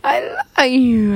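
high, tearful cooing; baby's tender voice. Retold or imitated. Voicing the baby to his mom, high and teary. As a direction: coo like a loving baby. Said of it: "He's like".

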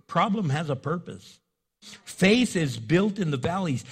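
ordinary talk

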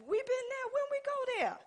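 high, squeaky mock surprise; preacher mimicking her own baffled reply to her husband; mock disbelief. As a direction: act amazed you ever went there. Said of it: "I be like".

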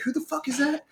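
high, held-back mock yell; retold angry challenge. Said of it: "pent up anger. And you were like".

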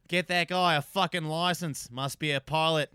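Aussie bloke, slow and loud; Australian accent, amused. Shown as a demonstration. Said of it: "the guy that, talked about the snowboarder".

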